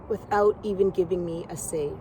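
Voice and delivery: ordinary talk, nothing marked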